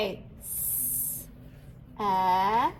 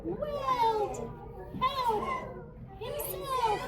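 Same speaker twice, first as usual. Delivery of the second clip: much higher squeaky little insect voice; teeny tiny bug voice; 11 semitones higher, 6 dB quieter, much slower than usual. Shown as a demonstration. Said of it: "let's use teeny tiny bug voices".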